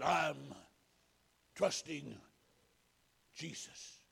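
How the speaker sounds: halting, weak whisper fading to almost nothing; dying man's faint, broken whisper. A narrator's reading. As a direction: whisper it out between long pauses. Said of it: "In just a whisper".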